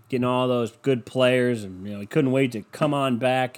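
normal talking voice

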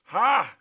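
loud, breathy "ha!"; excited exclamation. Real, not acted. Preacher thrilled by his own message, excited shout. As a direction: let out a loud burst of excitement. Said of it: "I'm excited".

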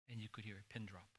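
breathy hush, fast; whisper. Real, not acted. Preacher conveys the silent room in a whisper. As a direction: whisper it to the congregation.

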